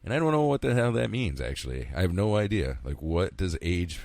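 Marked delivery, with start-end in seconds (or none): none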